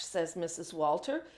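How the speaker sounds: plain reading voice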